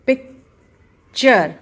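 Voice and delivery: पिक्चर stretched out with a clear च; loud slow demo. Shown as a demonstration. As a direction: belt out पिक्चर with a च. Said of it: "च की आवाज़".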